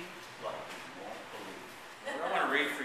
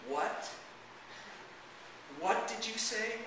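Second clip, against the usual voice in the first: higher, slow disbelieving question; stunned question; 11 semitones higher, slower than usual. Acted. Father asks daughter to repeat the news, stunned. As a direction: ask it haltingly, as if stunned.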